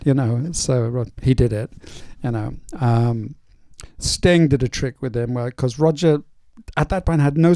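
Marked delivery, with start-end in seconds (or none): none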